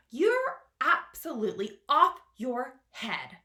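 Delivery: loud, indignant scolding; indignant earthworm. Acted. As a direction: tell him off.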